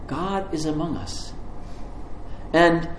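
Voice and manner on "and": rising, louder psalm proclamation; preaching at full force. Acted. Preacher to the congregation, ringing out the psalm lines. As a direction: proclaim each line like a psalm.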